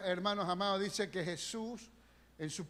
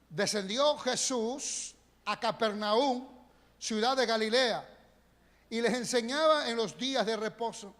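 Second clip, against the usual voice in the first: slow, solemn reading of the verse; scripture reading; 3 semitones higher, 4 dB louder than usual. Acted. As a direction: read it out like the pulpit Bible. (lectura solemne y lenta)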